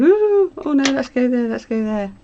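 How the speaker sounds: high, loud, eager brain voice; voicing an eager, racing mind; excited inner voice. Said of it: "my brain's going".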